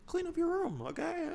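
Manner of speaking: very high-pitched comic mock impression; badly faked, squeaky high scolding voice; Jordan Peterson. Telling a listener to tidy the room, bad high-pitched impression. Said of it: "listen to Jordan Peterson".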